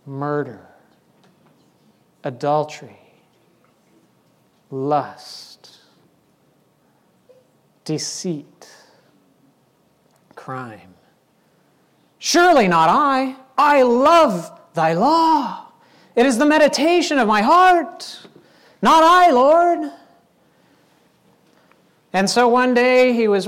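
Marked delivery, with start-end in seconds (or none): 0.1-10.9 low, hushed, one word at a time; name each one softly with long pauses; quiet, weighty list of sins; grave revelation. Acted. God naming David's hidden sins. 12.2-19.9 emphatic, drawn-out mock piety; hammed-up righteous denial; protesting innocence. David protesting his innocence.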